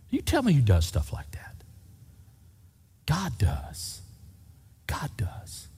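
soft, breathy whisper; hushed awe. Real, not acted. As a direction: let it drop to an awed whisper. Awed aside after the land miracle.

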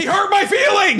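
high, loud fake whine; mock whiny shout. Cohost pretending he got his feelings hurt. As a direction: cry it out like a hurt kid.

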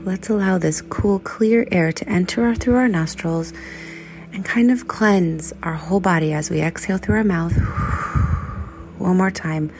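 soft, unhurried meditation guide with whispered lines; calm breathing-exercise voice; guided meditation. Acted. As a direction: ease listeners gently through the body scan. Leads a breathing body scan.

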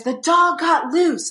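slow, high mock-panicked cry; imitated hysterical panic. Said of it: "he sounded hysterical".

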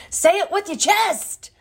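high, punchy comic yell; Kevin Hart catchphrase. Acted. Host borrows a comedian's line, bold comic yell. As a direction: fire it off fast, high and bold. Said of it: "That's, like, a Kevin Hart thing".

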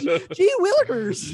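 very high, loud gee-whiz squeak; squeaky voice. Retold or imitated. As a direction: gush like a wide-eyed kid. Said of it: "With a squeaky voice".

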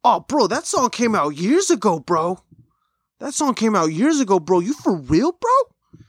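high, slow put-on voice of the DJ. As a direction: stretch it out high and amazed. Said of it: "And he goes".

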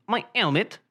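"helmet" said as 'elmet; mock British "helmet" without its H; dropped H. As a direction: insist on "helmet" with no H. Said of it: "Pronounce your H's".

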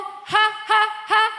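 high, loud mock ha-ha; forced laugh. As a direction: fake a laugh in protest. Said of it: "Do you want me to go".

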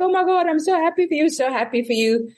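high, rapid gush of joy; retold squeal of delight, not shouted; thrilled friend. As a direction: imitate an overjoyed friend on the phone. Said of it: "we were, like, screaming on the phone".

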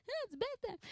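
put-on very high cutesy baby voice; voicing the baby. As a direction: squeak it like a happy newborn. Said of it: "'cause he's like".

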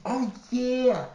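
high knowing "oh yeah" from the friend; a light put-on of the sly friend; friend's voice. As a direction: slip into the campy friend for a beat. Friend Richie giving cheeky advice.

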